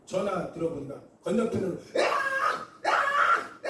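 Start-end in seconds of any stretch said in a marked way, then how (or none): 2.0-3.7 very high, loud imitated screams; piercing mock fit screams; possessed scream. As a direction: shriek like someone having a fit. Imitates screams heard over phone.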